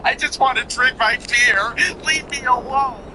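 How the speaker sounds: high whining imitation of a weepy singer, about to cry; whiny mock cry. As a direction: mimic a sobbing loser, high and whimpering.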